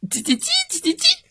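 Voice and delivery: very high, fast cheeping; chick chirping. Acted. Chicks on the windowsill, cheeping fast and high. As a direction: rattle off tiny cheeps.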